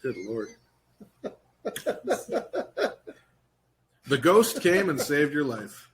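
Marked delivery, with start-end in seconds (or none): 1.6-3.1 high, wheezy laughing fit; real laughter. Real, not acted. Host cracking up at the kids joke, high laughter. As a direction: laugh helplessly in high bursts.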